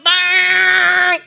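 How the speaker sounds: loud imitated horn blast; truck horn. Preacher imitates the truck horn, loud blast. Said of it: "yung busina ng truck".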